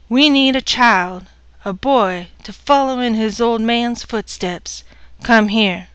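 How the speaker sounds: loud, bossy order; domineering demand. Acted. Husband ordering his wife over, loud and bossy. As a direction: command her like property.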